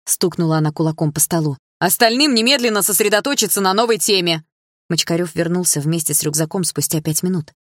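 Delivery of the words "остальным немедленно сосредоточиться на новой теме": higher, louder commanding teacher; teacher ordering the class; stern order. A narrator's reading. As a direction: drive the class back to work.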